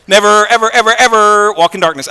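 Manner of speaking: "Never, ever, ever, ever" pounded word by word; emphatic never. Shown as a demonstration. Preacher repeating the biggest never in the Bible, hammered "Never, ever, ever, ever". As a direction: punch "Never" and pile on each "ever". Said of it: "This is the biggest never".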